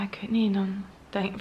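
ordinary talk

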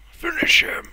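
sudden high whispered "Finish him!"; mock fighting game announcer. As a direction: hiss it like a game announcer's command.

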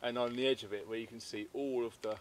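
ordinary talk

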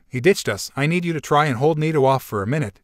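usual speaking voice

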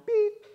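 high sharp electronic beep; voicemail beep. Retold or imitated. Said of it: "Or your voicemail".

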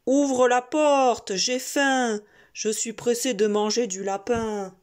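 menacing wolf at the door; big bad wolf. Hungry wolf demands entry.

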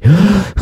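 sharp, high theatrical gasp; mock shocked gasp. Mock shock at conspiracy headline.

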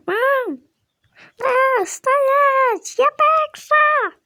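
high, loud shout, slowing on the last words. Pretend. Child racing mom, high loud shout. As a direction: yell it to win the race. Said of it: "що ти кричиш?".

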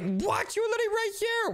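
high pitched incredulous cry; disbelief. Real, not acted. Brother hiding right next to her, high incredulous cry. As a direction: squeak it in disbelief.

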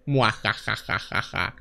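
low soft evil chuckle; slow sinister villain cackle; mustache twirling villain. Acted. Evil laugh, quiet, low.

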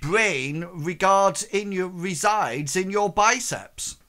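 offended noblewoman belittling her guest; haughty scolding. Acted. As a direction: look down on him and cut him apart. Lady insults suitor's wits.

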